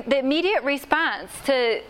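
usual speaking voice

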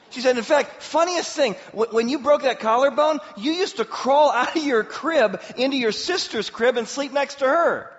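high, loud, tickled mock-mom storytelling; amused retelling. Mother recalls her child's crib escapes, amused retelling. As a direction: imitate a mother gleefully sharing a funny memory.

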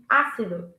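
ácido stressed on open "á", falling; stress on first syllable. Shown as a demonstration. Teacher modelling the word, "ácido" stressed on Á. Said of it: "you stress the letter".

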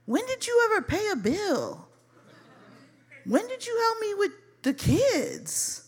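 high-pitched disbelieving questions; incredulous challenge. Retold or imitated. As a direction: question his claims with swooping high pitch. Sister challenging his claims.

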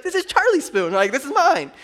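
put-on squeaky toddler insisting; little girl voice. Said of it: "she's like".

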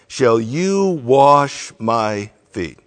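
slow, loud stress on "you"; very emphatic. Retold or imitated. Peter objecting to Jesus washing him, very emphatic. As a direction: draw out "you" and weigh each word. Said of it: "he's very emphatic".

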